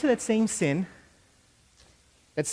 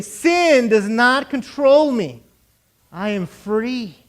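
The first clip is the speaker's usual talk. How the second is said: slow, emphatic proclamation easing at the end; loud declaration; 4 semitones higher, 9 dB louder, much slower than usual. Shown as a demonstration. Preacher has them say it with him, loud and slow. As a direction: declare loudly, then settle on the last words. Leading the declaration once more.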